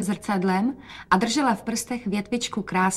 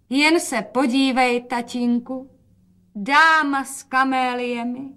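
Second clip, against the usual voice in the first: high, slow showy announcement; proud presentation; 5 semitones higher, slower than usual. A narrator's reading. Mother shows daughter off to father, proudly announcing. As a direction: present it grandly, stretching the words.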